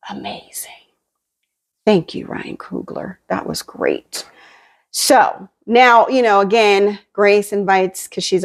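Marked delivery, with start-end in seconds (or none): 0.1-4.3 awed whisper of praise. Real, not acted. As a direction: breathe it out, almost no voice.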